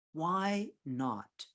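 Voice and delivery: "Why not" stretched out word by word; deliberate, drawn-out "Why not"; slow, emphatic. Real, not acted. Repeats it slowly for emphasis.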